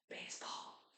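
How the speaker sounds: very soft whispered test word. Shown as a demonstration. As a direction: whisper it from behind for a hearing test. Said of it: "I'm gonna whisper something".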